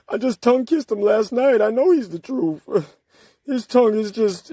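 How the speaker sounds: high, sneering mock voice that sinks back toward normal; mocking lovestruck fan. Mocking a rival's followers as lovesick fans, in a high sneering voice. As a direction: gush like a smitten follower, dripping with ridicule. Mocking opponent as smitten boyfriend.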